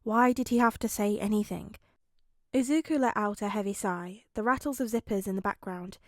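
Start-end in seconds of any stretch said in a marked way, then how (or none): none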